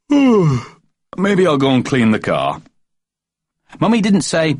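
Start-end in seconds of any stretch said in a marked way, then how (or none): 0.1-2.6 slow yawn through the words. A narrator's reading. Daddy announcing lazily after football, slow yawning. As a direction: say it mid-yawn.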